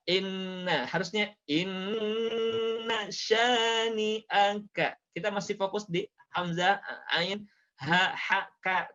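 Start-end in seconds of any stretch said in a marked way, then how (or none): 0.1-0.9 "Inna" short, the N not held; rushed N. Shown as a demonstration. Teacher showing the common mistake, hurried "Inna". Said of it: "in-nya sering tergesa-gesa". 1.5-4.9 "inna" with a long humming N, chanted; unhurried held N. Shown as a demonstration. Teacher correcting hasty learners, slow chant with "inna" held long. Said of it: "in-nya sering tergesa-gesa".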